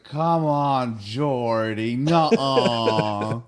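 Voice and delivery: loud, whining mock protest; whiny protest. Wesley protests to Geordi.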